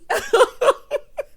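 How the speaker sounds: loud, high, helpless laughing; big laugh. Real, not acted. Host laughing at remembered sitcom episode, big laugh. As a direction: crack up at the memory.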